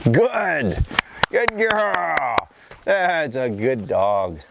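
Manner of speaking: slow, gushing praise for the dog. Real, not acted. Praising dog after a retrieve.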